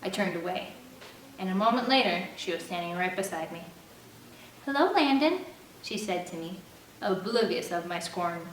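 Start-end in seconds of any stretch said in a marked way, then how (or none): 4.6-5.4 lightly voiced cheerful girl, higher; a hint of a sweet church girl; Jamie's sweet voice. A narrator's reading. Narrator voicing Jamie greeting Landon, lightly sweet.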